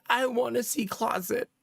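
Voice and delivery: imitating a whiny, tearful toddler; lightly acted toddler meltdown; mock toddler crying. Toddler crying to see the closet, mock tearful whine. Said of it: "started crying".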